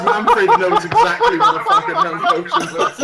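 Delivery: high, loud laughing speech; laughing hard. Real, not acted. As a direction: squeal it out through helpless laughter. Cracking up at the mustache twitch.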